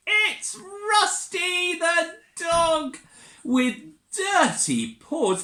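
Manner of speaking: high, slow sung-out reveal; excited reveal. Acted. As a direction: announce the surprise in a sing-song. Reveals dog under tablecloth.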